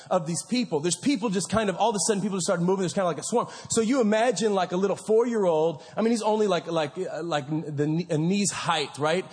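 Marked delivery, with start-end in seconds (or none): none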